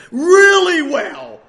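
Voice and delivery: Really stretched slow and loud; preacher leaning hard on Really well; stress on Really well. Real, not acted. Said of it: "stretched emphasis".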